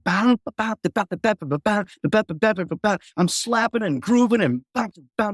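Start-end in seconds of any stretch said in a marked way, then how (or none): 0.0-3.0 scatting a funky slap bass line; scatted slap bass. Retold or imitated. Bassist showing the room his slap bass groove, scatted. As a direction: mouth the bass groove in rhythm. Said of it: "I just start going".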